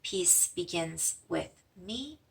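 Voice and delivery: slow, soft meditation chant; calm mantra, drawn out. Shown as a demonstration. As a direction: say the mantra gently on the breath. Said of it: "breathing in".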